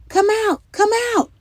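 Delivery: high, loud repeated call. A narrator's reading. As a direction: rouse the neighbours from their homes. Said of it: "they all called".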